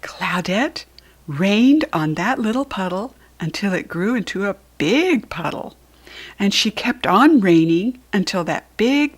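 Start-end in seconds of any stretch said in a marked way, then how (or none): none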